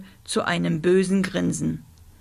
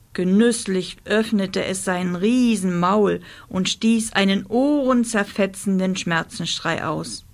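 ordinary delivery

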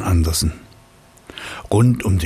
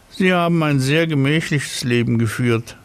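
high, self-important snail; a smug little snail, pitched up; 7 semitones higher than usual. Acted. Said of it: "sagte die Schnecke".

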